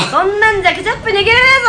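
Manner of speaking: loud harsh scolding; scolding drill instructor. Acted. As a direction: yell at the trainee. (鬼教官の叱責)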